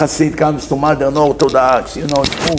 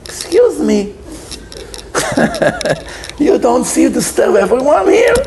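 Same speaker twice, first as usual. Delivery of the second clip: offended doctor; 7 semitones higher than usual. Acted. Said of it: "The doctor over there".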